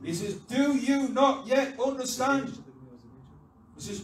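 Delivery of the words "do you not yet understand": loud, high, slow question; acted emphatic reproach; exasperated rebuke. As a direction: spell it out, every word punched. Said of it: "he doesn't say it in a Yorkshire accent".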